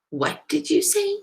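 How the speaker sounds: high, rising disbelief; incredulous kid's question; taken-aback question. A narrator's reading. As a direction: ask as if you misheard your friend. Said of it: "Salma asked, thinking she must have misunderstood".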